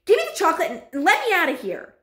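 put-on high, loud demand from a boy; suspicious shout. A narrator's reading. Suspicious boy wanting to leave the witches, shouting. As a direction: shout to be let out. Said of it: "shouted Bruno, becoming suddenly suspicious".

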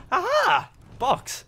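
high, loud exclamation; triumphant discovery. Real, not acted. Player spots the box, triumphant cry. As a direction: crow it on spotting what you wanted.

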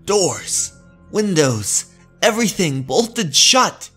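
high, loud, nervous and jumpy; fearful muttering. Acted. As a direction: talk to calm your own fear. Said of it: "Muttering aloud fearfully".

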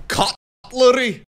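high, drawn-out mock bewilderment; baffled retelling. Tourist asked about cutlery in a restaurant, baffled. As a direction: stretch the puzzling word out in mock disbelief. Acts out baffled reaction in Scotland.

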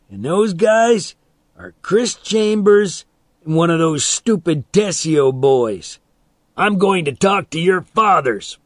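name them loudly as a threat; angry threat. A narrator's reading. Man threatens to tell boys' fathers, angrily.

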